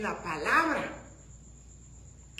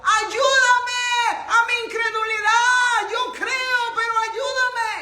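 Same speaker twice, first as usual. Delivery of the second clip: pleading shout; 9 semitones higher, 6 dB louder than usual. Retold or imitated. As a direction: cry out for help as the father. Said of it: "todavía pidió ayuda".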